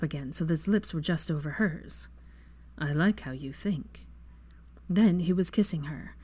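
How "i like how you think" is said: soft low murmur. Acted. Draco intimate line.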